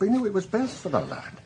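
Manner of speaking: ordinary talk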